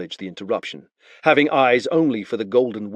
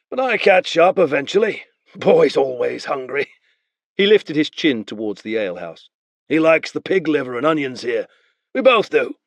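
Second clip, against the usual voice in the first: easy higher false friendliness; Beren bluffing; 6 semitones higher, 4 dB louder than usual. Acted. Beren casually bluffs the spearman to free the boy. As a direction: talk the soldier round.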